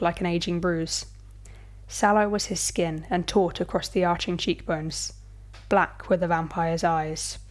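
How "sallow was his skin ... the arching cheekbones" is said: plain reading voice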